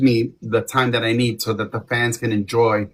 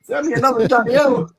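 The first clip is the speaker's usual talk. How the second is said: high gospel-style cry to the Lord; mock gospel plea; 13 semitones higher than usual. Singer retelling stage panic as a high gospel plea. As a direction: call out like a preacher asking for help. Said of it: "I'm like".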